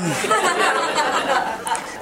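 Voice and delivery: a burst of high, amused laughter; bright amused laugh at his own joke. Real, not acted. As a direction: break into a laugh at yourself. Laughs at own pronunciation aside.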